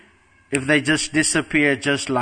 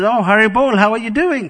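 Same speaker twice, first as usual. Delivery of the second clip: much higher and louder, hammed-up happy mother; repeated cheerful greeting; 7 semitones higher, 4 dB louder than usual. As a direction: call it out again, big and delighted.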